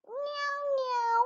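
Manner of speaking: very high mewing meow; teacher mewing in the review; cat meow imitation. Shown as a demonstration. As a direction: mew like a tiny kitten, way up high. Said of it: "one more time".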